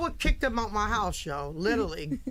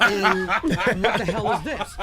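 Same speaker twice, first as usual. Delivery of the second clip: laughing; 9 dB louder, slower than usual. Real, not acted. Mom hearing the record, laughing.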